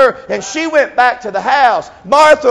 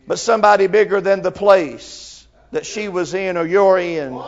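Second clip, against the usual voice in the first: normal talking voice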